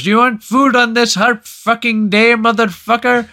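put-on high, loud, angry Indian accent; hammed-up Indian-accented outburst. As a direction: imitate an irate Indian shopkeeper. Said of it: "the Indian guy caves".

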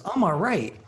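high, loud, swooping "I'm all right"; contrasting all right. Shown as a demonstration. Man showing how his friend could have answered, high and emphatic.

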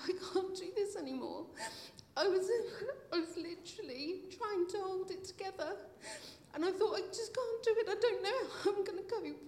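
real tears rising in a church testimony; tearful. Real, not acted. Retelling despair, holding back tears.

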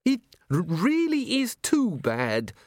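squeaky, slow, fussy little man; prim, stammering museum curator. Acted. Curator complaining.

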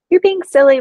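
high, loud brush-off: you're being silly; mock-scolding adult brushing off a child; dismissive adult.

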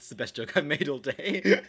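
loud, laughing through the words. Real, not acted. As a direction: crack up at your own joke. Proud of own joke, laughing.

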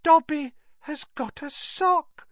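high elf voice, stunned; can't believe it, small creature; elf in disbelief. Acted. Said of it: "said Dobby in disbelief".

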